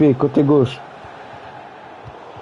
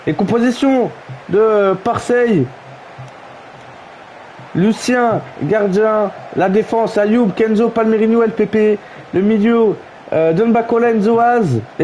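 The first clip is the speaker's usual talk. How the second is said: higher, announcer-style lineup read; 4 semitones higher than usual. Acted. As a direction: read the team sheet like a stadium announcer.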